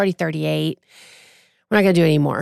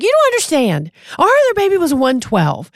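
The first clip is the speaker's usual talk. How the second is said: emphatic retold explanation; 10 semitones higher, 4 dB louder than usual. Husband explaining to startled nurses.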